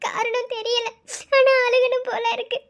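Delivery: tearful confession with sobbing breaths; crying. Acted. Confiding sleeplessness to Akalya, crying. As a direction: hold back sobs as you speak. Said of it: "அதான் வந்து அழுதுட்டு இருக்கேன்".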